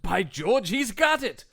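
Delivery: lightly voiced triumphant professor, rising high; triumphant exclaim. Triumphant quote of a teacher.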